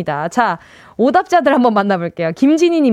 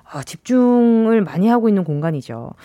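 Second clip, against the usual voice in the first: ordinary talk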